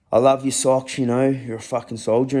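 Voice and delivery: put-on loud, high, fond cheering of the old dog; affectionate praise to dog. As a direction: lift the dog up with proud loving praise. Kneeling down with his old dog.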